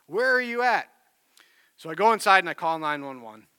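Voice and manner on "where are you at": higher, louder mock yell for a lost child; retold yell. Dad running through the woods for his son, retold yell. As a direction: call out as if searching, without full screaming. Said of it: "yelling for my son".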